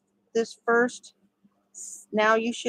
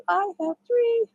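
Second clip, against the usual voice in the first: "I have three" sung out much higher; sing-song count; 12 semitones higher than usual. Shown as a demonstration. Counting stitches.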